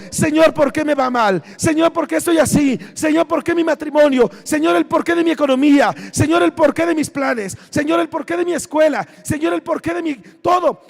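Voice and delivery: ordinary delivery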